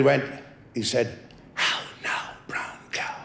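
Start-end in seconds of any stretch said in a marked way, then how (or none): 1.5-3.1 breathy whispered test phrase from the doctor; quiet whisper, just for a listener to catch; doctor's whisper. Retold or imitated. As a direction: whisper it across the room like a hearing test. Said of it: "I'm gonna whisper something to you".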